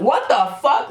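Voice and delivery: shocked. Retold or imitated. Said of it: "I'm like".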